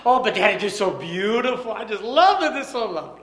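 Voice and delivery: put-on high, loud child in love with her pearls; adoring little girl. Little girl tells daddy she loves the pearls, high. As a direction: gush over the pearls. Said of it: "little girl protests".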